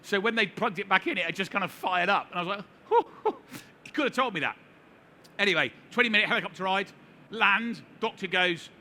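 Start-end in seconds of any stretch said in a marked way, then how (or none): 2.9-3.4 much higher, louder breathy hoo hoo; relieved hoot. Retold or imitated. Told the defibrillator was only charging, relieved high hoots. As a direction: let the fright out in two high hoots.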